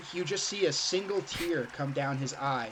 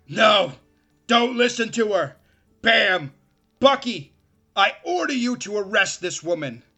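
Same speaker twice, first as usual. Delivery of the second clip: loud, higher frantic orders; desperate villain command; 5 semitones higher, 8 dB louder than usual. Acted. The mayor orders the sheriff, loud and desperate. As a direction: bark orders as you lose control.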